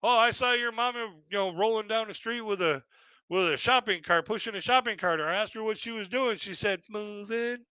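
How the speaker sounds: troll trading your mama jokes; mocking joke. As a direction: deliver the insult with a smug grin. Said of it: "And he's like".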